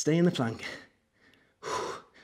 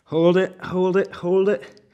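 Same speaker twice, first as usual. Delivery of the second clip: urgent coaching push; 4 semitones higher, 5 dB louder, slower than usual. Real, not acted. Coach urging hold during plank.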